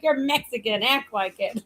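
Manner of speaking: ordinary talk, nothing marked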